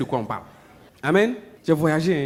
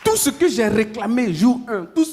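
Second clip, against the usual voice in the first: preaching at full force; 8 semitones higher, 5 dB louder than usual. Acted. Preacher to church, the answer arrives fast: fervent preaching. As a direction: drive the point home to the congregation. Preaching climax over music.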